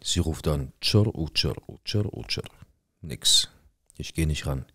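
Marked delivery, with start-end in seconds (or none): 0.8-2.4 Klingeln nachgemacht. Retold or imitated. Telefon klingelt, imitiert.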